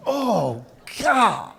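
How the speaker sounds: ordinary talk, nothing marked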